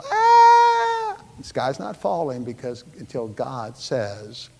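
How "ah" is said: high, loud mock scream of panic. Preacher mimicking nervous people, hammed-up shriek. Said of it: "get nervous and go".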